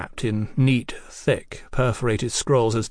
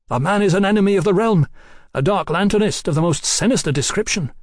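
high, urgent, louder accusation; heated denunciation; 7 semitones higher, 4 dB louder than usual. Acted. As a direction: denounce a villain in alarm. Mallory denounces the conspirator.

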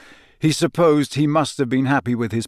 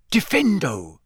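higher-pitched incantation with a falling sweep; spell casting; 5 semitones higher than usual. A narrator's reading. Harry aiming his wand at a bag, incanting. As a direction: cast the spell with intent.

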